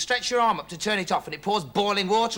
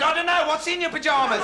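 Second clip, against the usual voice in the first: neighbour; 9 semitones higher than usual. Acted. Acted neighbour shouts back.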